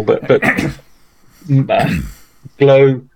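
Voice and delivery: talking through hiccups; hiccuping mid sentence. Real, not acted. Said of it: "excuse me, hiccuping".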